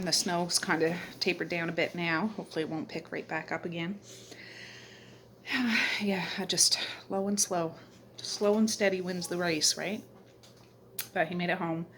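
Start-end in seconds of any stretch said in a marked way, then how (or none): none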